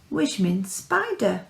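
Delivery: ordinary delivery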